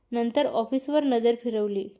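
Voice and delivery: ordinary delivery, nothing marked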